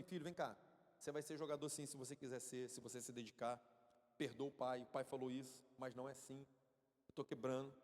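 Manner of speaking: low, calm fatherly reassurance; retold father quietly encouraging his son; tender apology. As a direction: drop low and take it back gently.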